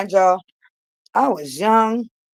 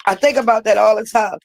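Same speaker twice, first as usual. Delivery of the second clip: ordinary talk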